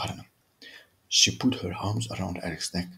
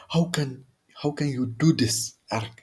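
high, slow anguished question; distressed plea; 5 semitones higher, slower than usual. Acted. Kristen begging Eric why he does this, high and slowed. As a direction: plead with him in disbelief.

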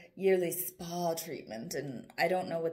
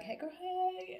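much higher mock flirty call from a guy; 12 semitones higher, 6 dB quieter, slower than usual. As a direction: tease a guy's cheesy pickup greeting. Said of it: "be like".

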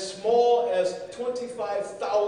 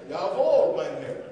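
put-on German accent; 7 dB louder than usual. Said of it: "a southern German accent".

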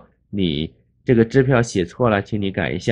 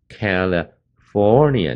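"California" slowly split, cal-uh, then long FOR-nia; stress on FOR; much slower than usual. Shown as a demonstration. Teacher showing the stressed part, "California" slowly with heavy FOR. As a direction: stretch and lean on FOR in "California". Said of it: "那个for的地方最重".

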